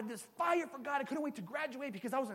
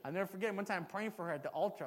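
ordinary talk

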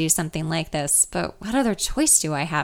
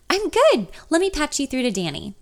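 perky receptionist; 6 semitones higher than usual. Acted. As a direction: chirp it down the phone. Receptionist voiced on the phone.

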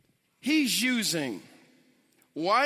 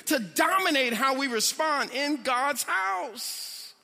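preaching; 6 semitones higher than usual. Acted. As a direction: preach it with rising passion. Preaching stays at full force.

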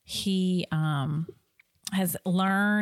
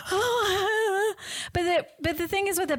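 imitating anxious partner; 13 semitones higher than usual. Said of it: "you are just like".

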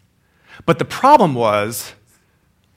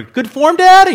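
put-on much higher, eager kid's question; young boy asking; 7 dB louder, slower than usual. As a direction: squeak it up like a boy seeking praise. Boy asking his dad.

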